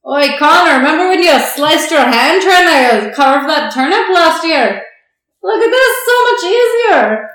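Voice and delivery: loud, high, hammed-up Irish brogue; mock Irish accent. Irish carver teasing Connor about last year's turnip, shouted mock Irish. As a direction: call out to a mate in exaggerated fake Irish. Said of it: "sorry to all Irish people".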